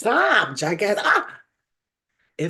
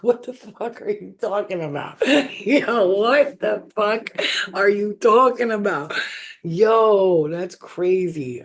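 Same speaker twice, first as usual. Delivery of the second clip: loud, laughing what the fuck; laughing disbelief; 4 semitones higher, 4 dB louder, slower than usual. Real, not acted. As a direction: stretch it out in amused shock.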